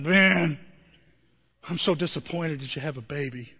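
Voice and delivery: mock disappointment. Mock disappointed family member.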